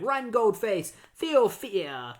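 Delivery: high, theatrical jeer; mock villain taunt. Retelling villain jeering at hobbling Goldface, mock taunt.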